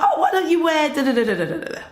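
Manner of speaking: lightly hammed-up boyfriend, fast and high; mock bossy boyfriend. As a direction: rattle it off into babble, imitating him. Acting out boyfriend dictating her outfit.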